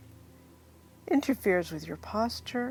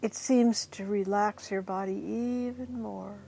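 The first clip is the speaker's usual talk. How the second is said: guided hypnosis; 5 dB quieter than usual. Acted. Leads a guided hypnosis induction.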